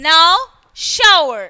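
now, shower with "ow" as in how. Shown as a demonstration. Teacher reviewing the how column, OW demo. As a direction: model now and shower separately with that glide. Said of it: "Pronounced as in how".

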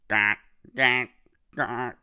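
loud, bold duck quacks; big ducks quacking. Retold or imitated. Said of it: "The big ducks say".

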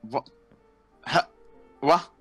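ordinary talk, nothing marked

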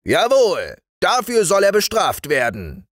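high, forceful sergeant's verdict; emphatic high-voiced military assent; fat sergeant. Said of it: "sagte der dicke Wachtmeister".

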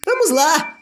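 ordem aguda e bufada; Percy bufando. Acted. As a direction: bufa impaciente com os vagões. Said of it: "Percy bufou". (Percy bufa para os vagões, agudo e impaciente)

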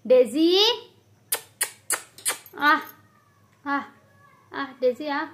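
loud rising call. Real, not acted. Owner calling Daisy loudly to come eat. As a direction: call the cat from a distance.

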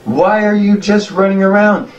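put-on an old goblin screaming at the fleeing crowd; panicked old goblin. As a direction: warn them frantically in a high old voice. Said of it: "and he goes".

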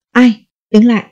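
sharp call to halt in the dark; soldiers' challenge shout. A narrator's reading. Patrol soldiers stopping a boy at night, loud challenge. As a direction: bark out the challenge. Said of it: "Các anh hô".

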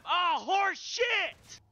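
high, drawn-out cry of protest; angry outburst. Real, not acted. Player curses at a game setback, angry outburst.

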